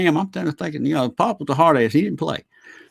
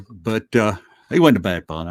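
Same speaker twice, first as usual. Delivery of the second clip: ordinary delivery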